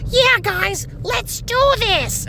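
very high, slow squeaky cheer; mock the chirpy ghost drawing it out; comic relief voice. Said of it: "it's a comic relief voice".

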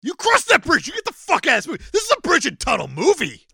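high, loud ranting outburst; mock angry yelling. Co-host throwing someone out of the movie, mock-furious. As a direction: yell it like an over-the-top tirade.

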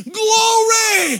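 high, loud, drawn-out shout of praise; exultant cry. Acted. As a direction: cry it out in rapture, holding the word. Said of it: "You're gonna say".

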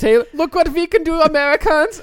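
put-on loud, high German accent. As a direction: shout it in a hammy German accent. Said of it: "Okay, that was, that was German".

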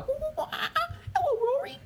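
squeaky high toy monkey. Acted. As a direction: chirp it like a tiny monkey. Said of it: "the monkey's like".

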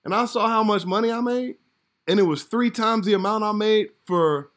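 can't believe the money you made; amazed disbelief. Retold or imitated. Actor telling hosts about his first TV check, amazed. Retelling shock at the paycheck.

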